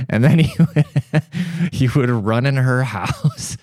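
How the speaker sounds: high, giggling through the story; can barely get it out for laughing; laughing through words. Real, not acted. Telling a friend about the cat, laughing hard.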